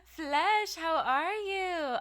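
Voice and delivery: loud, high happy hello to a chatter; excited greeting. Real, not acted. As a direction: call out warmly across the room. Calling a chatter loudly.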